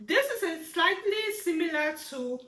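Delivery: ordinary delivery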